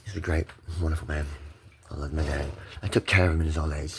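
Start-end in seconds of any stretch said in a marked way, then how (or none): 0.0-2.5 soft, lower loving praise; subdued affection. Real, not acted. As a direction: settle down into quiet affection. Retreats into loving dad.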